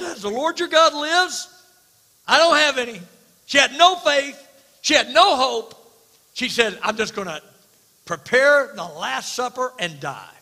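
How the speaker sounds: loud, high, fervent preaching; full-force preaching. Acted. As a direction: thunder it out from the pulpit.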